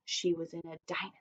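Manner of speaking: fast, soft whisper; a hushed, hurried aside. Shown as a demonstration. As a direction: drop to a quick whisper for the reveal. Model sentence ends whispered.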